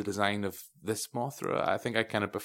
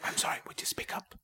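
hushed, voiceless whisper; mock whispering; 7 dB quieter than usual. Mocking Kyle Chandler's constant whispering in the film, in a whisper. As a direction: whisper it, sending up an actor's whispering. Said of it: "He does so much whispering".

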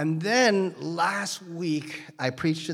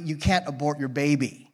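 ordinary talk

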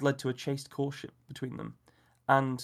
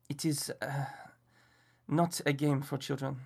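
put-on French accent. A narrator's reading. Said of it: "a strange Frenchman".

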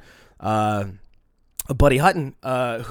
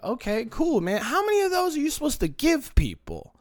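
sarcastic retort; 11 semitones higher, 3 dB quieter than usual. Retold or imitated. Quoting himself sarcastic.

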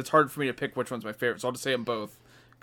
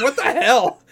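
higher, louder laughing what the hell; laughing mock shock; 10 semitones higher, 8 dB louder than usual. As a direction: laugh out the disbelief. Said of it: "I was like".

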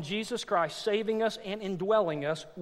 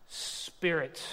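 ordinary talk, nothing marked

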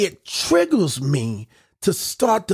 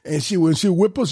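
ordinary talk

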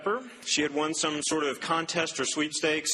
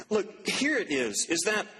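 ordinary delivery

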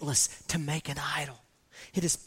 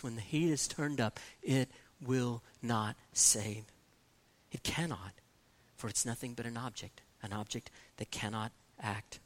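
hushed and grave; 8 semitones lower, 6 dB quieter than usual. Real, not acted. Preacher drops after the climax.

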